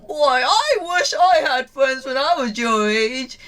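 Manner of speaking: put-on old man sighing over his lonely youth; wistful old craftsman. Old craftsman telling Senku he wished for friends, wistful. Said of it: "Kaseki sees this beautiful friendship and is like".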